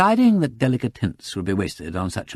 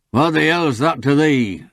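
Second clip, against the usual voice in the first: higher, slow dialect challenge; ferocious growl; 6 semitones higher, 5 dB louder, slower than usual. A narrator's reading. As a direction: round on the visitor angrily, drawing it out. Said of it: "growled the farmer".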